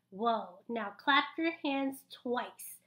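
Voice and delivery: usual speaking voice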